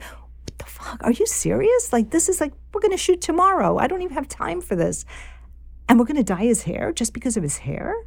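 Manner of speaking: high, incredulous outburst, the first words whispered; retold outrage. Director on the eve of the shoot, venting disbelief at the studio note. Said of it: "I was, like, angry".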